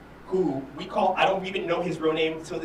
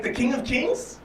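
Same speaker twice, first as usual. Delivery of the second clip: mock disbelief; 8 semitones higher than usual. Retold incredulous question.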